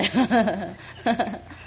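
short laugh rising in pitch; amused rising laugh; real laugh. Real, not acted. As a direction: burst out laughing. Laughs at her own Mandarin.

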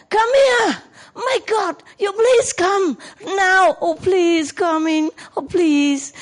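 high-pitched begging, loud at first, then easing; desperate pleading. Shown as a demonstration. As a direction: plead slowly and high with a dog that won't come. Acts out begging a disobedient dog.

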